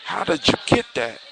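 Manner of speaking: high-pitched, shocked question; astonished princess in a told tale. A narrator's reading. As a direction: ask it high, in storyteller's shock. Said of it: "is shocked and runs to him and says".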